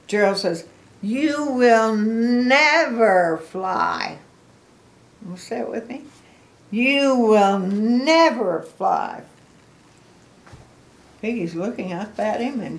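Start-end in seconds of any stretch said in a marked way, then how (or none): 1.0-4.2 loud, slow decree with a big NEVER; Gerald. Acted. Gerald tells Piggy flatly no, loud and drawn out. 6.7-9.2 loud, slow refusal leaning on "never"; Gerald. Acted. Grandma has children say it with her, loud and drawn out.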